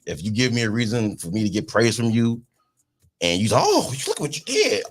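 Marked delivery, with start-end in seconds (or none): 3.6-4.8 high praising voice. Retold or imitated.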